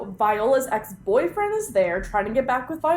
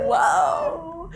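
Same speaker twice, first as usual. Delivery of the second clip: whoa; 7 semitones higher than usual. Real, not acted. Reacting to the reveal.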